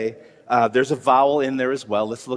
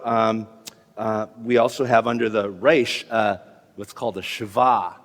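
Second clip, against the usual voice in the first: normal talking voice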